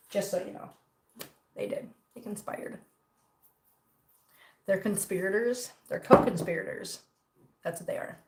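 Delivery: whispered aside, mock-secret; conspiratorial whisper; hushed aside. Real, not acted. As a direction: lean in and whisper it like gossip. Mock-secret aside about friends.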